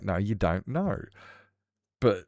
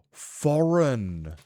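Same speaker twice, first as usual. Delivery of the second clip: gasp of realization; 6 semitones higher, 6 dB louder than usual. Retold or imitated. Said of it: "And I'm like".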